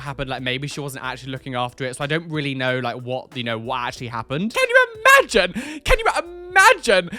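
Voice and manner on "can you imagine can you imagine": high, loud, horrified; horrified squeal. Real, not acted. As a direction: shriek it in disbelief. Horrified at a rotting ear.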